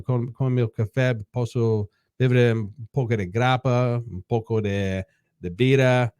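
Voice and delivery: halting Italian sentences on request; spoken Italian. Shown as a demonstration. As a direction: speak your best Italian, hesitantly. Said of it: "maybe three sentences in Italian".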